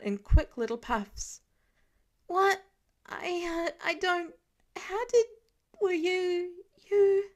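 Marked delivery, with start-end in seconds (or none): 2.3-7.3 high, loud, broken panicked gasps; hyperventilating stammer. A narrator's reading. Terrified kid stammers at Tony, high and breathless. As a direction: stumble over every word in panic. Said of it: "practically starts to hyperventilate".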